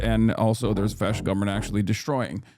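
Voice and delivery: rapid-fire streamer intro; show-opening patter. Real, not acted. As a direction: rattle off the welcome in one rolling stream. Opens the live broadcast.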